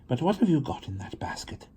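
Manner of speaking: whispered nosy question; a girl asking the stranger in a whisper; curious whisper. A narrator's reading. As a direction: whisper it, barely able to hold in a giggle.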